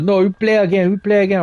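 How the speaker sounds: hammed-up demand to keep playing; mock angry kid protest. German kid refusing to give up the court, mock angry protest. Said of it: "He's like".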